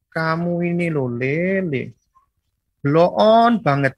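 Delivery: a gentle-sounding jab that stings; slow but cutting. Retold or imitated. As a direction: drawl the insult out slowly, sweetly. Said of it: "Kadang-kadang bisa lambat, tapi ternyata menusuk-nusuk".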